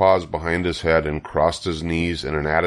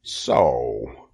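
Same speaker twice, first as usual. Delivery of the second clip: low, drawn disgusted rat; contemptuous low "so"; 5 semitones lower than usual. A narrator's reading. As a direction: let it drop low with disgust. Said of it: "he said in disgust".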